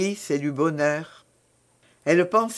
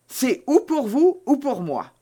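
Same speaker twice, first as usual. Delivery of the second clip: high-pitched, agitated alarm; 9 semitones higher, 4 dB louder than usual. A narrator's reading. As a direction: blurt it out, pitched well up. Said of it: "comme vous semblez agitée".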